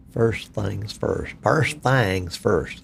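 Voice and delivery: slow, stretched-out Southern accent; exaggerated Alabama accent. Shown as a demonstration. Streamer showing how people think they talk down here, hammed-up Southern accent. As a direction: stretch THINGS into a drawn-out Southern "thangs". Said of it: "That's how they say it down here".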